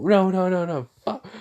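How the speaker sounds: high, rising panic; panicked no no. Retold or imitated. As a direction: repeat no in mounting panic. Shadow panicking in retelling.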